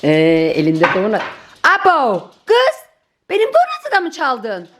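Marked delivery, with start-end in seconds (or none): 1.6-2.3 louder startled cry; surprised exclaim. Real, not acted. Dog stole the donut. 2.5-4.7 scolding shout. Real, not acted. Scolding dog for stealing.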